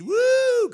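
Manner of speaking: high, loud congregation whoop; excited whoop. Acted. Mimicking an excited congregation, high loud whoop. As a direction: let out a big "woo".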